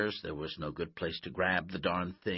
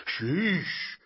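loud, high exclamation of dismay; raised-voice burst of disbelief; exclaimed; 10 semitones higher, 6 dB louder than usual. A narrator's reading. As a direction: blurt it out as a startled coach. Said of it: "Coach exclaimed".

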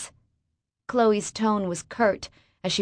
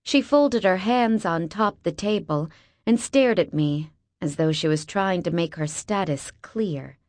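ordinary delivery

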